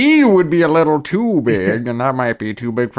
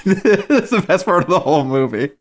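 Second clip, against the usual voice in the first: ordinary delivery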